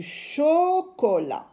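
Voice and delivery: CHOCOLAT with a long CHO, then CO, LA; syllable-by-syllable word. Shown as a demonstration. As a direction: split "chocolat" into held syllables. Teacher splits word into syllables.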